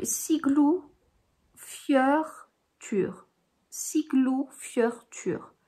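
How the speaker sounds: "Siglufjörður" very slowly, part by part; "Siglufjörður" as Siglu, fyeur, tur, with pauses between; guided pronunciation. Shown as a demonstration. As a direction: sound out "Siglufjörður" in careful chunks, ending in "-tur". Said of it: "c'est comme ça que ça, c'est censé se dire".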